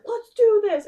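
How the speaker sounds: imitating an over-eager friend, high and loud; excited urging. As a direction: burst out high and loud, pitching a wild idea. Imitates friend pitching wild ideas.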